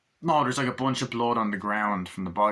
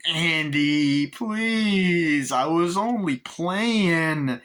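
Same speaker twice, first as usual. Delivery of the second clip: high, loud, slow begging doll voice; pleading Chucky; 7 semitones higher, 6 dB louder, much slower than usual. Retold or imitated. Chucky begs Andy not to press it, slow pleading. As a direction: drag out a desperate plea. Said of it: "Chucky goes in".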